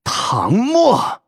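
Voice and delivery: seething, drawn-out name swooping up high; high, strained growl of fury; through gritted teeth. A narrator's reading. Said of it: "从牙齿缝里吐出两个字".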